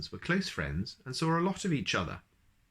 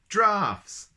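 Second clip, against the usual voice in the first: loud high reveal; spring the joke; triumphant punchline; 11 semitones higher, 8 dB louder than usual. Acted. Mister Nonsense revealing the draughty pun, triumphant and loud.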